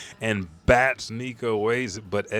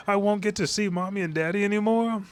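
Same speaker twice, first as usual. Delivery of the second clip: put-on much higher, fast childlike wail; whiny little kid; 12 semitones higher, faster than usual. Kidnapped child whines about never seeing his parents, high and fast. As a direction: sound like a scared little kid. Frightened kids taken from home.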